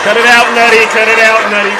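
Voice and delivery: laughing, calling out loudly to a viewer; playful loud call to a friend in the chat. Real, not acted. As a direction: shout it out through a laugh, teasing a viewer.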